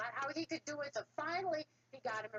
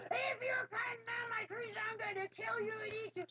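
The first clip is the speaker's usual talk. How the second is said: shouting troll; 11 semitones higher than usual. Acted. Troll threatens the boy.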